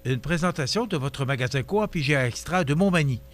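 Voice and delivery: ordinary talk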